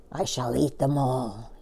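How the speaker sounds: a sly monster promising himself a feast; greedy crocodile muttering. A narrator's reading. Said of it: "he said to himself".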